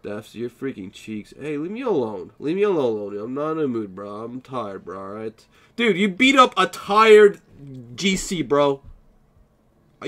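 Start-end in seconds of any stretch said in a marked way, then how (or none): 5.7-8.8 loud, slow indignant yell at the winner; mock outraged yell. Real, not acted. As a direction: protest loudly at being beaten while tired. Streamer yells at opponent who won.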